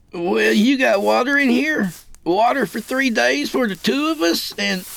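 high-pitched worried questioning; dawning alarm. A narrator's reading. Kid realizing the water must last three days, alarmed. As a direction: voice climbs high as the realization hits. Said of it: "blanching with the realization".

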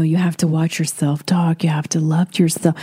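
breathy hushed guru voice; mock the airy-fairy self-help crowd; mocking self-help whisper. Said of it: "these self-help people would say".

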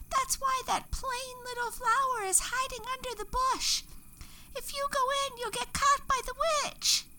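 high little flower voices calling out; chorus of tiny flowers crying out in alarm; flowers shouting. A narrator's reading. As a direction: shout a warning as tiny wildflowers. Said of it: "all the wildflowers shouted again".

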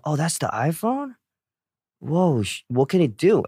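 high, hushed amazement, half-voiced; amazed. Retelling his first look at the iPhone, hushed high amazement. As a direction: marvel at it like a kid seeing magic.

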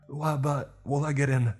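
high, panicky stammer; flustered. A narrator's reading. Toby hesitating with the mop, flustered. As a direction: sputter a worried question, high.

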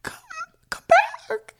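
very high cry with a crack; Rose from Titanic. Retold or imitated. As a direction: let the voice break as you call. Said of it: "It has to have, like, a little crack".

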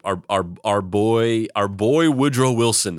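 ordinary delivery

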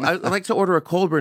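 quick flustered order; hurried retry in English. Retold or imitated. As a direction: rush the English order, embarrassed. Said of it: "And I go".

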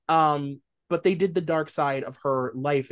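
ordinary talk

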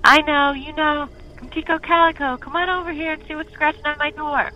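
high, loud call; calling the dogs. Acted. Smitty calling the dogs from the window, high and loud. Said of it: "eased to the window and called".

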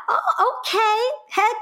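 high, surprised oh okay, easing back down; mildly flattered surprise, then agreeing; pleasantly surprised. Retold or imitated. Acts out her surprised agreement.

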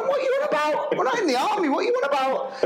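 high-pitched, laughing disbelief; incredulous protest. Retold or imitated. Teacher mocking his colleague's army talk, high incredulous protest. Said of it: "I went".

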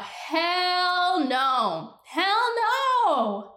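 climbing incredulous no, pitch jumping up high; emphatic refusal. Real, not acted. Outraged rejection, rising.

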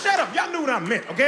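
usual speaking voice

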